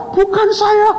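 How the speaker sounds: very high squealed denial; hammed-up terrified student; mock tearful panic. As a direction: plead innocence in a comic wail.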